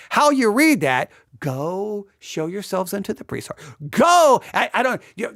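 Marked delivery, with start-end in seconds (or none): 1.4-3.5 softer, understated instruction; gentle reading. Shown as a demonstration. Preacher shows how inflection changes the verse, soft reading. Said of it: "the inflection the pastor uses here can greatly impact". 3.9-4.4 loud, high, barked order; forceful command. Shown as a demonstration. Preacher shows how inflection changes the verse, forceful shout. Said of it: "the inflection the pastor uses here can greatly impact".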